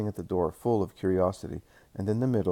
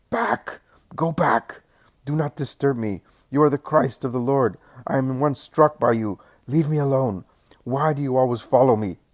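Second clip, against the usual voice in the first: higher, louder demonic shout; 5 semitones higher, 6 dB louder than usual. Acted. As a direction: shriek it out like the demon inside the girl. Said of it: "she shouts".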